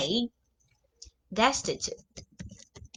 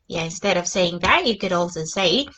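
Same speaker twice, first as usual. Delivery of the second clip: usual speaking voice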